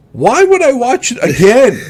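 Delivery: high, loud disbelief at his own rewatching; incredulous at himself. Real, not acted. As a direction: ask yourself why, amazed at your own habit. Laughing at himself.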